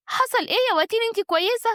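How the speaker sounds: high-pitched worried questioning; alarmed concern. Acted. Sister checks on the collapsed wife, alarmed. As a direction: ask in anxious alarm.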